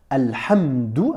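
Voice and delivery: Alhamdou drawn out very slowly, ending in a rise; slow careful demonstration. Shown as a demonstration. Teacher showing the child the word, slowly. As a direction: spell out Alhamdou syllable by syllable, slowly. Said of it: "le son dou ... quand tu dis alhamdou".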